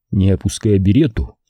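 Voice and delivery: ordinary delivery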